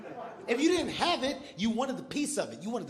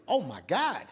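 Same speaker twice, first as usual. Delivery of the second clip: ordinary talk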